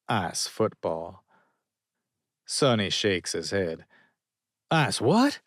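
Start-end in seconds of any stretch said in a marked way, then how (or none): 4.7-5.4 baffled. A narrator's reading. Sonny baffled by ice football.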